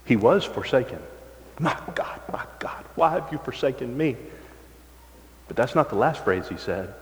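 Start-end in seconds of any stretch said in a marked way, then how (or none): 1.6-4.2 hushed, mostly whispered plea; whispered cry of forsakenness. Acted. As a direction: whisper it like a dying man's prayer. Quoting Christ's cry, whispered.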